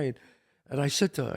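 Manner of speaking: ordinary talk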